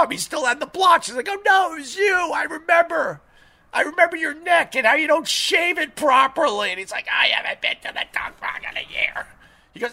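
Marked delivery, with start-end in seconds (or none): 1.5-6.7 high-pitched, insistent finger-pointing; retold accusation. Recognising the owner by his blotchy neck, retold accusation. 7.1-9.3 whispery, voiceless mock excuse; mocking imitation. Dog owner repeating his excuse, mocked in a whisper.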